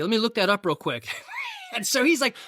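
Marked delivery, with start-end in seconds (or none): 0.0-1.1 mock helpful. Dad calls tire-slashing bluff. 1.1-1.7 burst of laughter. Real, not acted. Laughing at own joke.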